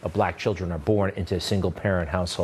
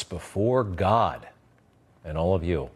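each word drawn out slowly, weighing the oath; slow sarcastic echo of "Before God"; slow mocking repeat; 4 semitones lower than usual. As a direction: repeat the promise slowly, letting it hang. Host slowly repeats Biden's oath.

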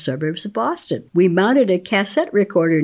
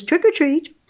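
put-on child at door; 11 semitones higher than usual. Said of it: "Child says".

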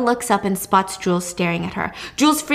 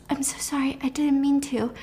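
soft, meek apology; nice apology; 3 semitones higher, 7 dB quieter than usual. Acted. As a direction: apologise gently, nearly under the breath. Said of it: "tries to nicely apologize".

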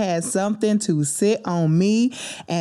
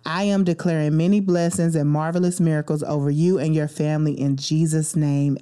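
ordinary delivery

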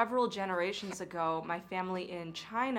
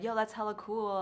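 put-on Northern California accent. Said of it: "they say hella a lot".

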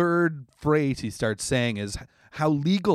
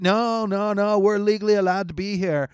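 a bit higher and louder stubborn voice; mock insistent; 5 semitones higher, 3 dB louder than usual. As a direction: insist smugly. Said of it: "And they're like".

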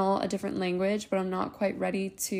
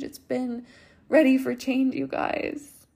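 getting emotional; 4 semitones higher than usual. Real, not acted. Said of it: "I'm just getting really emotional right now".

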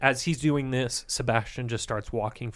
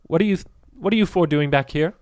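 louder, high alarmed priest; stern priest; 7 semitones higher, 5 dB louder than usual. Acted. Priest confronting strangers near the orphans, alarmed and louder. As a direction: challenge the intruders protectively. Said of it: "he looks and he goes".

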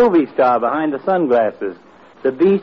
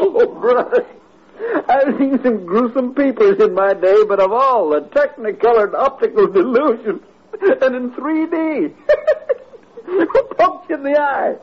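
high voice breaking into laughter; mock him through giggles; laughing; 8 semitones higher than usual. Herb laughing at McGee's shiner.

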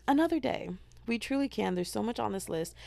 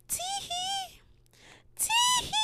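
mock giggle; much slower than usual. Showing how she giggled at night, tiny high giggle.

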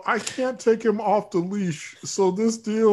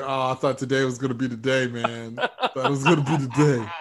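mopey dog voice; 8 semitones lower, faster than usual. Pretend. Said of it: "the dogs are like".

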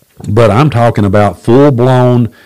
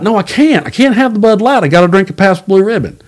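pitched-up comic refusal, playing the prophecy's dude; hammed-up, insisting on the Pabst; higher mock insistence; 8 semitones higher than usual. Speaker acts insisting on Pabst.

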